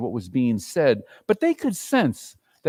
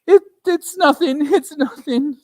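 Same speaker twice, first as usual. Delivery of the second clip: higher, stammering mother; mother hiding tears; 12 semitones higher, 8 dB louder than usual. Acted. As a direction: brush it off, covering tears.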